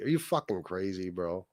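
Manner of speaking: ordinary delivery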